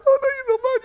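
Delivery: very high, tearful-sounding gushing thanks; overjoyed thanks. Retold or imitated. Preacher voicing the lucky caller, high gushing thanks. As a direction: gush thanks almost in tears. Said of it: "And you're like".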